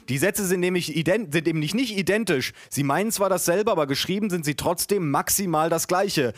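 high-pitched pedantic argument; insistent nitpicking. Acted. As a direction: argue the wording with mounting insistence. Acted pedantic argument in story.

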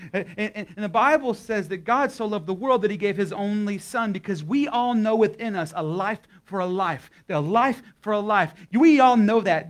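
climbing, impassioned altar-call preaching; preaching at full force. Acted. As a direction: build it like a revival preacher. Sermon climb to the gospel.

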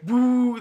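high, loud jeering "woo"; heckler's woo. Acted. Tavern patrons giving the bard grief, loud hoot. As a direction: hoot at the performer. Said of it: "patrons kind of heckle you".

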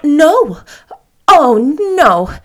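loud flustered denial, high; cried out. Acted. Anne denying to the stranger, crying out. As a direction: blurt it out, caught off guard. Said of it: "cried Anne".

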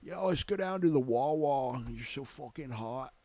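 brash Philadelphia accent, hammed-up; Philly accent. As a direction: play a Philly guy, heavy Philadelphia accent. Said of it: "He talks like he's from Philly".

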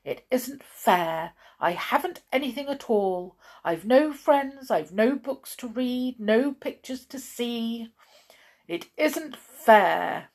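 self-pitying grumble, leaning on each grievance; aggrieved muttering. A narrator's reading. Blind man complaining to himself as he walks, aggrieved. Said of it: "muttering to himself as he went".

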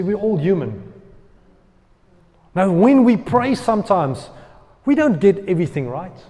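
ordinary talk, nothing marked

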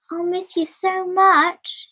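sad farewell. Acted. Dorothy's tearful goodbye to friends.

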